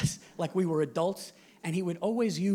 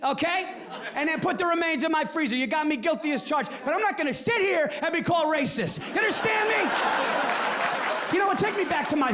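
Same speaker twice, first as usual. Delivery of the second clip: defiant killer rant; 7 semitones higher than usual. Acted. Cornered killer snapping at the detectives, high and defiant. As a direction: play the cornered killer refusing the label.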